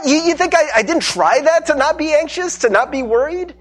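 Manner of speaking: high, loud incredulous questioning; exasperated retort. Acted. Anxious person answering "don't be anxious", exasperated. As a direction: throw the useless advice back at them.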